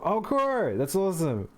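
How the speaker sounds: bright burst of real delight; genuinely thrilled, pitch jumping up; excited. Real, not acted. Friend bought the game.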